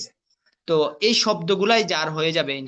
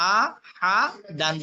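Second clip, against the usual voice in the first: আ, হা, দান one syllable at a time; broken syllables; 3 semitones higher than usual. Shown as a demonstration. Teacher showing learners broken pronunciation, syllable by syllable. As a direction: split আ, হা, দান with a pause after each. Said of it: "ভাঙ্গা উচ্চারণ করবেন একটা একটা করে".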